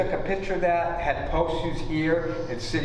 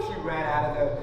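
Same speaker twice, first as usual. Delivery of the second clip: normal talking voice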